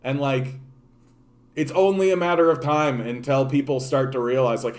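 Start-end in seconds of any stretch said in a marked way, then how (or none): none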